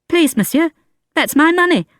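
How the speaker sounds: put-on high child voice, insistent; pleading boy. A narrator's reading. Boy pleading with Valjean for his money, high child voice. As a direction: beg again like a small boy.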